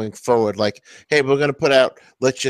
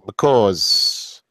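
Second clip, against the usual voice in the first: imitating a thick New York "because"; "because" said pih-KAWZ, New York style; New York accent. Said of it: "New York accent came out really thick on the because".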